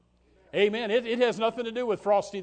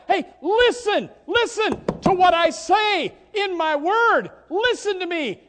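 God calling out; 10 semitones higher, 7 dB louder than usual. Retold or imitated. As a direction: urge them to listen with full force. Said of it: "he tells you and I".

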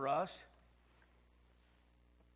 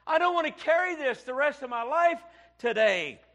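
put-on a higher, louder plea in the girl's words; girl's weary complaint; 7 semitones higher, 7 dB louder than usual. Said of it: "that little girl".

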